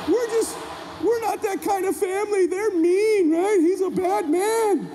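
high-pitched indignant mom voice; protective mother. Retold or imitated. Mom hugging her son in the kitchen, indignantly soothing.

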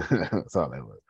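laughing it off. Real, not acted. Shrugging off his self-criticism to viewers, laughing. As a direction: chuckle through the words.

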